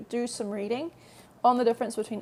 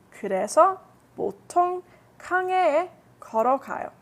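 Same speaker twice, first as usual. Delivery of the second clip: brisk, higher, louder read-through of the paragraph; reading aloud to class; 4 semitones higher, 4 dB louder, much faster than usual. Acted. Teacher reading Chris's paragraph to students, brisk and bright. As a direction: read the passage out crisply for listeners.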